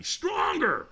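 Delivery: very high, strained mock scream; retold scream. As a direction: imitate a screaming trainer without full volume. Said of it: "he screamed at me".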